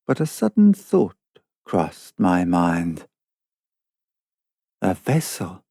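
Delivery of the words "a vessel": low, hushed murmur to oneself; softly, half to yourself; half aloud. A narrator's reading. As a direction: say it softly and lower, as a thought half voiced. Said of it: "I said, half aloud".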